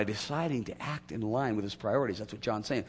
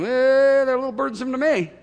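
high, louder, quick whiny protest; mock grumbling objection; 12 semitones higher, 7 dB louder than usual. Pastor voicing the congregation's complaint, high and whiny. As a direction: play the reluctant churchgoer pushing back.

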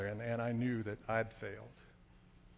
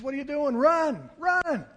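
high, loud, urgent father's shout; dad urging; 13 semitones higher, 10 dB louder than usual. Retold or imitated. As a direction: yell at your son to get moving. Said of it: "my dad looks at me and says".

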